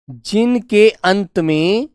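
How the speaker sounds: drawn out, word by word; slow dictation. Shown as a demonstration. Teacher repeating for pupils to follow, slow dictation. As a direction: spell it out slowly for note-takers.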